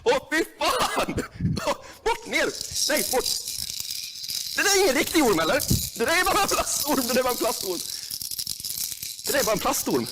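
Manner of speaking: high, strained squeals of fear; real high squealing fear; frightened squeal. Real, not acted. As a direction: shriek at the fake snake.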